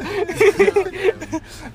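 high wheezing laugh; cracking up, high-pitched; hysterical laugh. Real, not acted. Laughing fit continues.

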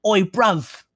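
high, punchy mock-Cockney call; Cockney geezer calling out; Cockney accent. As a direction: put on a playful Cockney accent. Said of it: "Cockney people".

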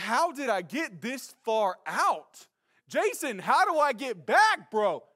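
loud, high, drawn-out plea; panicked calling. Retold or imitated. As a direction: call out for help across water. Panicked call to far-off friend.

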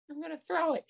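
put-on high little-kid answer. Young player answers his coach, high kid voice. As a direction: answer like an eager six-year-old.

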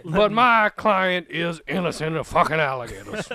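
loud, pompous southern courtroom lawyer. Pretend. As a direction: play it big, addressing the jury. Playing Buford the courtroom lawyer.

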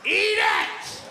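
loud barked order; mother shouting at her child; mom yelling. Retold or imitated. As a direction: snap it at the kid. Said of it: "and they".

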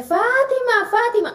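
high, drawn-out call of a name; retold calling out. Man calls her name in the street, calling out. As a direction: imitate someone calling from afar, high-pitched. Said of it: "yo escucho mi nombre".

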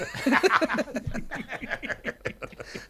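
a long, high peal of laughter. Real, not acted. Host laughs at his recycling joke, high laughter. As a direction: laugh at your own punchline.

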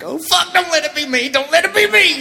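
high, loud frightened pleading; mock panic. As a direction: beg like you're about to lose. Scared of losing bug game.

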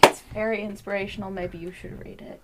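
low, very soft pitch; quiet coaxing. Acted. Player offers the book to an angry guard, quiet coaxing. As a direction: gently offer it to talk your way out.

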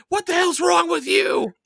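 very high-pitched imitation shriek; mock scream. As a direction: screech it as Lisa, exaggerated. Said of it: "like, screams".

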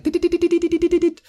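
very high quick "dit, dit" pattering; tiny steps noise. Retold or imitated. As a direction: mimic a headless chicken's little steps. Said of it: "in small tibbly tibbly steps".

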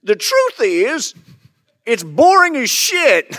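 put-on high, slow old coot, laughing through it; old prospector voice. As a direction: drag it out like a grumpy old hermit. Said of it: "prospector coot voice".

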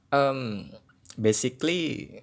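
slipping into Jaksel English filler; South Jakarta slang. Pretend. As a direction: say basically like a South Jakarta kid. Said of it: "kayak anak Jaksel ya".

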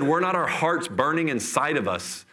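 ordinary talk, nothing marked

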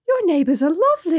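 high, loud gushing imitation; mock gushing. Podcaster imitating the London visitor praising her neighbours, mock gushing. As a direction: gush it high and loud through laughter.

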